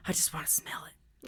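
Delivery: hushed, whispered longing; wistful whisper. Retold or imitated. Puppy-breath lover confessing to a friend, whispered.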